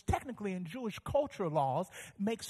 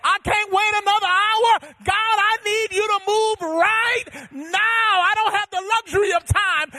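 high, loud, shouted sermon climax; preaching at full force; 10 semitones higher, 13 dB louder than usual. Acted. Preacher urging the desperate congregation to pray aloud, shouting. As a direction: drive the congregation to cry out.

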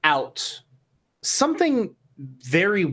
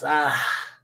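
satisfied whisper; 2 semitones lower than usual. Acted. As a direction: murmur it to yourself, pleased. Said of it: "He goes".

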